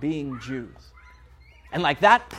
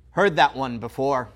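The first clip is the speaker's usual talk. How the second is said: ordinary talk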